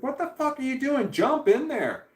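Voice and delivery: high-pitched re-enacted yell at the TV; retold screaming. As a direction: shriek it like an outraged viewer. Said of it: "I start screaming at the fucking T V".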